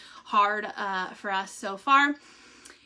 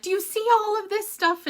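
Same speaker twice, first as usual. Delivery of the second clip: higher, eager imitation of the nurse; excited nurse; 10 semitones higher, 5 dB louder than usual. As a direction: show off the pile excitedly. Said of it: "she was like".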